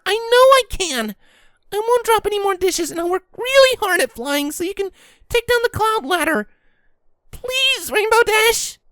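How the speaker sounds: put-on young filly begging to stay; pleading filly. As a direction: beg her not to send you back. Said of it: "Scootaloo pleaded".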